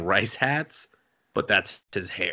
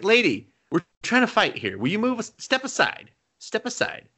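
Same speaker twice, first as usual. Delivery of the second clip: mock impatience; 10 semitones higher than usual. Fighters shoo woman aside, retold.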